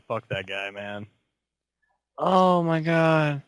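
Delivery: usual speaking voice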